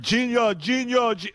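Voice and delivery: mock commentator cry of Genius; imitating the excited commentator. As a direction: echo the goal call like the commentator. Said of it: "You heard".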